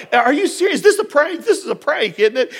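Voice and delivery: high, incredulous outburst; all excited. Retold or imitated. Pastor suspects the nurse of a prank, all excited. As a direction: re-enact giddy disbelief, pitch jumping up. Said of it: "I was all excited".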